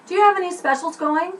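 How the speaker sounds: ordering pizza on the phone, brisk. Shown as a demonstration. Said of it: "So I'm gonna start here".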